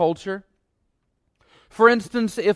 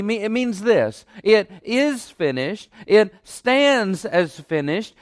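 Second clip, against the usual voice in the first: ordinary talk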